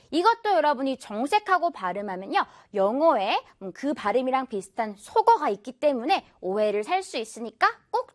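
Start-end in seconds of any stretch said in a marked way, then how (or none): none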